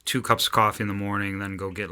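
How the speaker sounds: usual speaking voice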